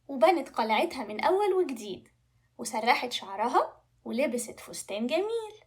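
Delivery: ordinary delivery, nothing marked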